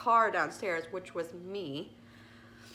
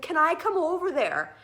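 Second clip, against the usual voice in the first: much louder and higher, pleading call; retold shout; 9 semitones higher, 9 dB louder than usual. Owner asking neighbor to let her come over, retold urgent shout. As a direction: holler the request across balconies. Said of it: "so I was shouting at him".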